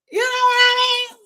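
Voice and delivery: very high, loud sustained squeak; playful squeal. Acted. Checking that viewers follow, playful high squeal. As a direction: squeal it out at the top of the voice. Comic squeal after the wail.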